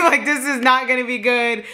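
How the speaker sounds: high, laughing repeat. Real, not acted. Telling how her sister knew trouble was coming, laughing. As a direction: crack up while repeating the warning.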